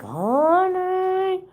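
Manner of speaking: drawn-out high honey; shocked honey. Retold or imitated. Retelling her reaction to the story, a long high honey. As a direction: stretch honey up high in disbelief. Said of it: "I was like".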